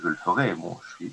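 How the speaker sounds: usual speaking voice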